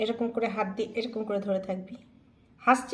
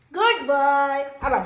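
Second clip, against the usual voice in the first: loud praise; 3 semitones higher, 7 dB louder, much slower than usual. Real, not acted. Praising dog after high five.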